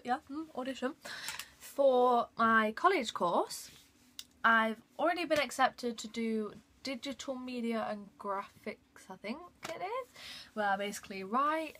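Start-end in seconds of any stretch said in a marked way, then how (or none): none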